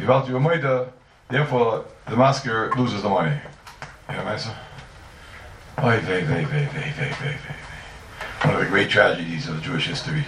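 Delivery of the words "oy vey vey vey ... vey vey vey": mock lament. Lament over tragic reading.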